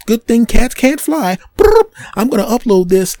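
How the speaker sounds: squeaky high chatter with brr trills; high bird vlogger voice. Acted. Bird films the cat for his vlog, high and squeaky. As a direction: narrate a vlog like a chirpy bird. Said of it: "He goes".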